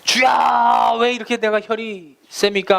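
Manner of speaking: high pleading shout to the Lord, fading at the end; crying out to God. Retold or imitated. Believer on the mountain shouting to God about his temper, desperate shout. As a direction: cry out to heaven from a mountain top. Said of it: "산에 올라가서 죽도록 소리 지릅니다".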